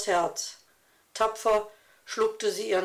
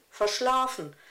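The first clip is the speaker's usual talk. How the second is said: ordinary delivery, nothing marked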